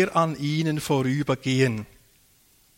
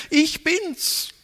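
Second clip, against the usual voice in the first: "Ich bin's" proclaimed much higher, "Ich" stressed; emphatic key word; 12 semitones higher than usual. Shown as a demonstration. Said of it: "Das ist das entscheidende Wort gewesen". ("Ich bin's" hoch und feierlich betont)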